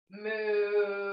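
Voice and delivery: a lightly voiced cow moo. Shown as a demonstration. Yoga teacher shows kids a cow moo. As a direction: demo the cow sound for the kids. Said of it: "So we're gonna say".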